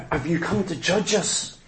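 a laughing, hushed demon voice; mock the demon softly, with a laugh. Preacher mocking the demons, in a hushed laughing voice.

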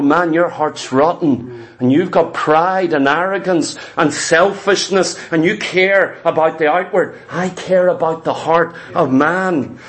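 a touch of solemn reproof, pitched low; lightly voiced low, convicting voice; voice of the Holy Spirit. Holy Spirit to a proud churchgoer, low and reproving. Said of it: "The Holy Spirit began to go".

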